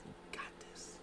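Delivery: whispered You got this; whispered self-pep talk. Retold or imitated. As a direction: breathe it to herself. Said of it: "Carmella's like".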